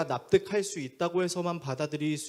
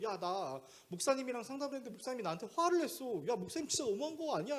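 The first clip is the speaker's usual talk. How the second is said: aggrieved complaint; 7 semitones higher, 4 dB quieter than usual. Retold or imitated. Young man complains to female friends.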